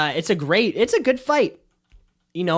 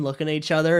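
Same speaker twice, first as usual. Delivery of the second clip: usual speaking voice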